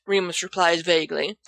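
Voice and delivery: ordinary delivery, nothing marked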